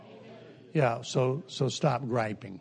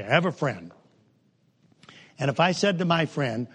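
ordinary delivery

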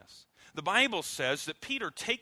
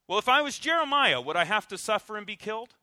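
pressing Jesus; 5 semitones higher, 4 dB louder than usual. Acted.

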